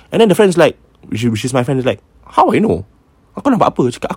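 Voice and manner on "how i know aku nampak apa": incredulous. Retold or imitated. Friend's incredulous retort.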